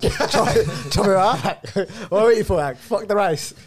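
excited laughing. Real, not acted. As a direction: laugh while getting hyped up. Excited mock plan, laughing.

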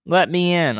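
a hint of a croaking frog; croaked. A narrator's reading. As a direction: plead lightly as a storybook frog. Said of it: "croaked a voice".

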